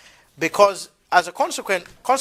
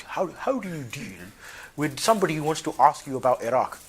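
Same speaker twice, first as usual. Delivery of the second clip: put-on posh English accent; 3 semitones lower, 3 dB quieter than usual. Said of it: "it's a very posh".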